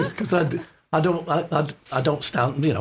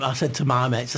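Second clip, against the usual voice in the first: ordinary delivery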